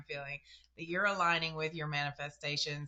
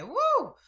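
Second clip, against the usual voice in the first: excited whoop; 9 dB louder than usual. Real, not acted. Excited about [name] trivia.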